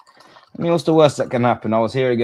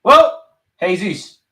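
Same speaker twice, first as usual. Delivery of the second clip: exasperated; 7 semitones higher, 5 dB louder, much slower than usual. Real, not acted. Reacting to bad moment.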